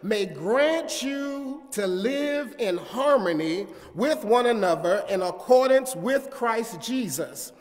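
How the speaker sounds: loud, rising preacher's cadence; preaching at full force. Acted. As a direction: build up the congregation. Sermon builds.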